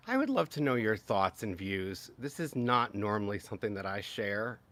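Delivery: usual speaking voice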